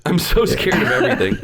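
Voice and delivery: ordinary talk, nothing marked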